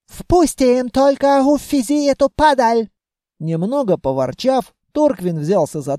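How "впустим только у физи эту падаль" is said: put-on high, impatient Germanic-accented voice; western elf accent. A narrator's reading. Officer agrees to readmit the carter, western accent. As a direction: get rid of them in an accented officer's voice. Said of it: "Акцент. Самый что ни на есть западный".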